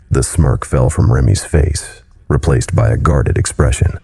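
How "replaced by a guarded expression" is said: ordinary delivery, nothing marked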